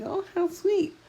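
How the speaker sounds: high-pitched gushing sister; imitated delight. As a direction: coo it, imitating a sister who loves affection. Voicing her touchy sister's delight.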